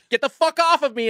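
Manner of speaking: retold fed-up outburst; angry retort. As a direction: finally snap at her to get off.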